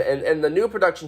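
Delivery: normal talking voice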